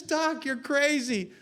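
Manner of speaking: high, drawn-out amused scoff; acted spy's amused jeer; mock laughing. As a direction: scoff at the doctor as if tickled. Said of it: "And he's laughing. The guy says".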